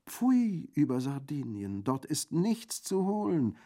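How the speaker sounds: high, loud, drawn-out disdain; scornful bandit. A narrator's reading. Theologian bandit seconding the refusal, scornful. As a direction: stretch the words in theatrical scorn.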